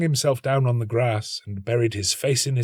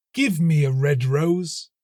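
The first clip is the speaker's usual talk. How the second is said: a little higher, earnest plea; pleading nightingale; 6 semitones higher, 5 dB louder, slower than usual. A narrator's reading. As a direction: beg the tree earnestly. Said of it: "she cried".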